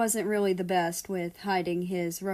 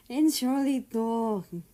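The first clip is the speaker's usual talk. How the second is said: apologizing; 4 semitones higher, 3 dB louder, slower than usual. A narrator's reading. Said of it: "Germany apologized".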